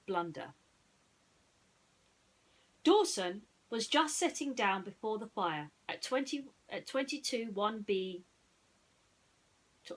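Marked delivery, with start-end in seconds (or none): none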